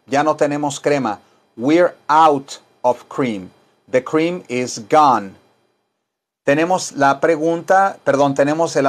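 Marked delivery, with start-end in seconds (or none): 1.6-5.3 very slow, words drawn out; slow model sentence. Shown as a demonstration. Teacher models slowly for class to repeat.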